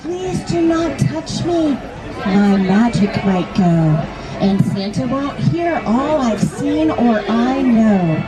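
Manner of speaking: plain reading voice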